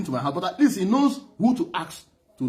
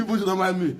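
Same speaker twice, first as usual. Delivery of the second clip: normal talking voice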